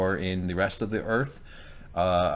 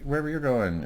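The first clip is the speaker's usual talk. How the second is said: higher-pitched imitation of a pleading girl; girl asking passage; 5 semitones higher than usual. Arya asks the sailor for a ride, lightly voiced higher plea. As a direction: pitch it up, lightly playing a girl's request.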